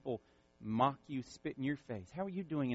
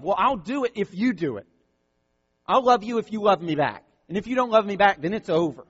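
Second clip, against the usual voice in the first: a hint of a petulant bargainer, louder; bargaining lover; 6 semitones higher, 11 dB louder, faster than usual. Pastor mimicking conditional love for the congregation. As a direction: lightly voice a tit-for-tat lover. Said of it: "a love that even demands love".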